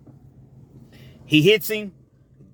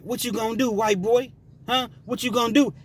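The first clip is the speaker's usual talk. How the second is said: higher, goading taunt; a mock prison taunt, egging him on; taunting challenge; 7 semitones higher than usual. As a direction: needle him, chin out.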